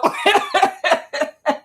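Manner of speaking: high, cackling laugh; real laughter. Real, not acted. Woman amused at her brother-in-law's situation, laughing. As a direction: crack up mid-thought. Mocks the sister's husband.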